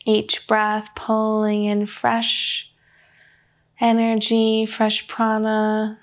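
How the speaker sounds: slow, even meditation voice with long pauses; unhurried guidance, leaving room for breath between phrases; guided meditation. Real, not acted. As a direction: lead them gently inward, phrase by measured phrase.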